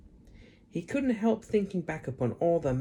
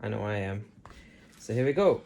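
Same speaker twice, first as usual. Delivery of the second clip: plain reading voice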